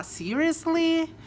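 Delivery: high, slow Seriously; appalled disbelief. Shown as a demonstration. Disagreeing with something horrible, slow appalled Seriously. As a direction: stretch it out, appalled. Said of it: "você não concorda, que coisa horrível".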